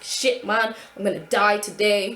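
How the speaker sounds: loud mock panic, dying on the seesaw; clutching the seesaw, screaming to herself in panic; panicked yell. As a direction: yell it like you're about to fall to your death. Panicked inner quote on seesaw.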